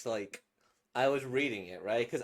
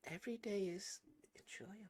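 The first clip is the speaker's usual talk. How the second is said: whisper; 5 semitones higher, 7 dB quieter, faster than usual. Acted. Soft whispered.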